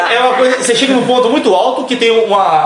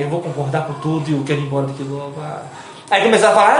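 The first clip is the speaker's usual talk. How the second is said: normal talking voice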